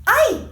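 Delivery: high, loud pained "I", like "eye"; painful long I. Shown as a demonstration. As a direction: yelp "I" as if it hurts. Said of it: "It's very painful".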